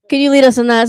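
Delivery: ordinary delivery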